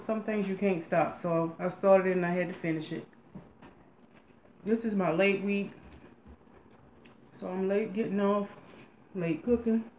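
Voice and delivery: chewing pasta between words; talking while chewing. Real, not acted. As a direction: mouth partly full of food. Seen 0: Woman puts pasta in her mouth, then chews between and during phrases.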